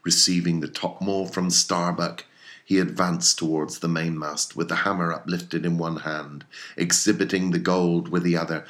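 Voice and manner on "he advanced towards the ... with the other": usual speaking voice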